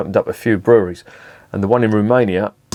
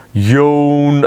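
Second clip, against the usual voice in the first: drawn-out, higher, louder mock boredom; mock yawn; 5 semitones higher, 4 dB louder than usual. Beer reviewer dismissing the brewery's green talk, mock bored. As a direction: stretch it out as a pretend yawn.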